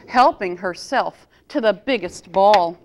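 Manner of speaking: plain reading voice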